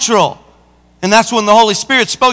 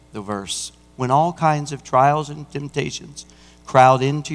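soft, low pulpit confiding; hushed preaching; 11 semitones lower, 10 dB quieter than usual. Acted. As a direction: lower the voice and let the verse land. Preacher drops to hushed reading.

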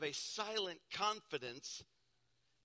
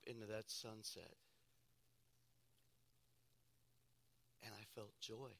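quiet, grieving recollection of mother's death; hushed grief; 5 semitones lower, 8 dB quieter than usual. Real, not acted. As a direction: let the grief hold the words back.

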